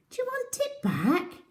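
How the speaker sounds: very high little mouse voice; squeaky mouse. Acted. Mouse offers the tooth back, tiny high squeak. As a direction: ask it like a tiny helpful mouse.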